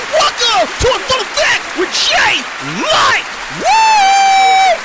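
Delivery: high, loud announcer hype; shouted welcome with a big whoop; hype host intro. Acted. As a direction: pump up the crowd like a hype man. Hyped host intro to show.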